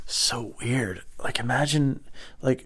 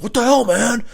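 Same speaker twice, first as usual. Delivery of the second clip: high, loud mock outrage; outraged shout; 14 semitones higher, 7 dB louder than usual. Driver confronts man who kicked fruit, outraged high shout. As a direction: yell it like a wronged driver.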